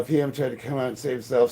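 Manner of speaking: ordinary talk, nothing marked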